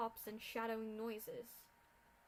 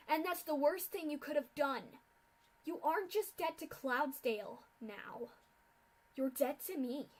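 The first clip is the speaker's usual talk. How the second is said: cold anger; 4 semitones higher, 8 dB louder than usual. A narrator's reading. Rainbow Dash condemning Scootaloo.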